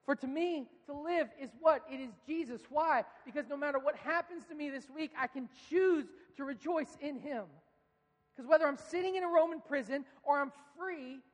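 high, loud preacher build; rising sermon fervor; fervent preaching. Acted. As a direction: preach it to the back row. Preaching at full force.

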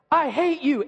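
high, loud mock-tantrum yell; child's angry outburst. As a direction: imitate a furious little boy lashing out. Acting angry son's outburst.

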